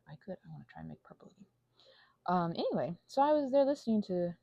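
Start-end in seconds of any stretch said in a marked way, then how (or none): 0.1-1.4 fast whispered aside. Real, not acted. Distracted by painting, fast whisper to herself. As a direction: rush it under your breath.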